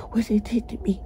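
hushed, shaken whisper; real whisper. Real, not acted. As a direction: drop to a whisper. Retelling abuse in hushed voice.

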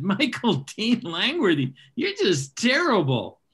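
mock-scold your son fondly; mother scolding. Mother teasing her son over risque jokes, mock scolding. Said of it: "she always said".

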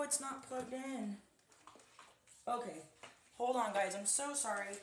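ordinary delivery

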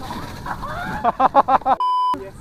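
shrieking high laughter. Real, not acted. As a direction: squeal with laughter. Laughing after a rough descent.

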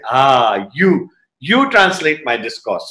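slow, low, unhurried choosing; lightly voiced master picking someone out, low and slow; retold guru's decree. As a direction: imitate a calm, low-pitched order. Retold Swami picking a translator.

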